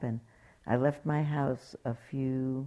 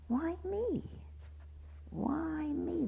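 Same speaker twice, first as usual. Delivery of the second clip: high, plaintive lament, much softer; anguished why me; 6 dB quieter, much slower than usual. Retold or imitated. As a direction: cry it out like someone pleading with fate. Martha despairing over her cancer.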